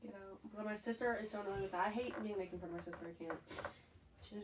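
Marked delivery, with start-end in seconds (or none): none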